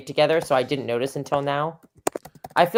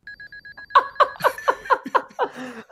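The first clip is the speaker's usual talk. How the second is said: laughing. Real, not acted. Misheard name, laughs at self.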